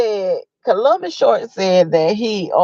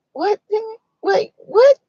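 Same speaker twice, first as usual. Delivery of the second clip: mock stunned; 13 semitones higher than usual. Fab looking at him like.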